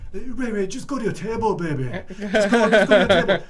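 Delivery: high, soothing, loud mock boss voice calling her baby; hammed-up high cooing boss. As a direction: coo it high like a paternal boss shooing a child. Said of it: "I can still hear it echoing".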